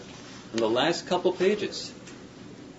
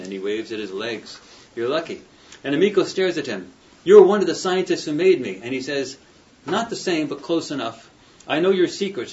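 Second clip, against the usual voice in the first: louder, dramatic book reading with character lines; reading a novel aloud; 5 dB louder than usual. Acted. Professor reading the novel's confrontation to his students, dramatic. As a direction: read the scene out to the class, giving the voices a little life.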